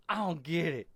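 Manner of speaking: loud, high outburst of exasperation; frustrated yell. Retold or imitated. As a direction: burst out, fed up with not getting it. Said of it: "This shit frustrate so bad".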